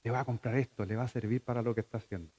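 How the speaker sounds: hushed, quick private musing; soft, fast thinking aloud; inner thought. Retold or imitated. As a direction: murmur it softly to yourself, like a father planning. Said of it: "y digo".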